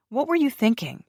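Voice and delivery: high, loud exasperated question; exasperated shout. Acted. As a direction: scold him sharply. Grace scolds, slapping him.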